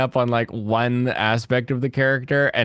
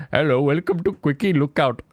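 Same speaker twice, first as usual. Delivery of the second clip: put-on Indian accent; 3 semitones higher than usual. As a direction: greet in a hammed-up Indian accent. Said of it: "He has the worst Indian accent".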